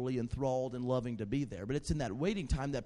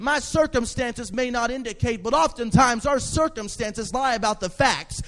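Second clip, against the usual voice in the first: louder, rising exhortation; fervent preaching; 6 semitones higher, 10 dB louder than usual. Acted. Pastor urging the congregation to stand with authority, fervent preaching. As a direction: build the room up with conviction.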